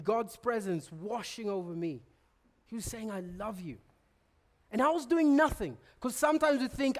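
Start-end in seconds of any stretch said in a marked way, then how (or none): 3.1-3.8 tender whisper of love. Retold or imitated. God speaking to him.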